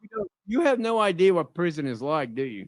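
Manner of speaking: unsteady, breaking voice; voice cracking. Real, not acted. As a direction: voice cracks as it trails off. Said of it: "Why is your voice cracking?".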